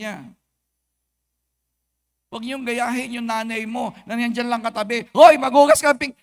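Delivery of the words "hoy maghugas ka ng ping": sudden loud mock yell of a nagging mother. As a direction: bark it like a mom calling from the sink. Mother yelling at child nearby. (sigaw ng nanay na nag-uutos)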